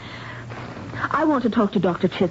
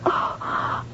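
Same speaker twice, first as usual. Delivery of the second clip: dismayed gasp; 6 dB quieter than usual. Acted. Nurse ordered to move patient.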